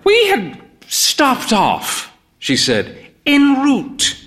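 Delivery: put-on high, slow frail old lady; slowed, lifted voice of the old woman; old mother voice. A narrator's reading. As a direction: pitch her up like a proud elderly mother.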